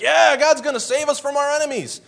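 high, eager shout; cheering crowd. Retold or imitated. Israelites cheering, high and eager. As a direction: cheer like God is on your side.

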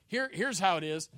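normal talking voice

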